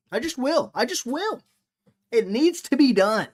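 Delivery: high, loud and adamant; emphatic insistence. Real, not acted. Streamer vowing to slander a fighter, loudly insistent. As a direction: insist on it with rising force.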